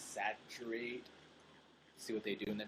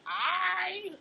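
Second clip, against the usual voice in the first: squeaky, much higher and louder Australian "I"; mock Australian accent; 8 dB louder than usual. Streamer trying out an Australian vowel, mock Australian accent. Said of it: "But you're Australian, you're like".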